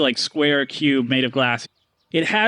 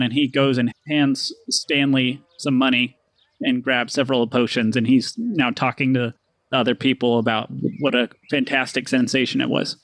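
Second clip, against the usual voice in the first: ordinary delivery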